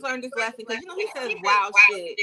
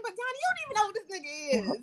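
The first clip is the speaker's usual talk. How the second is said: talking back to [name]; 9 semitones higher, 4 dB quieter, much faster than usual. Retold or imitated. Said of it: "I'm like".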